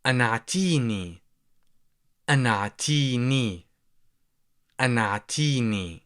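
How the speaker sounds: anatini said slowly with a glottal stop; slow demo. Shown as a demonstration. Teacher slowly repeating anatini for learners. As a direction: draw out each syllable of anatini. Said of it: "you would say".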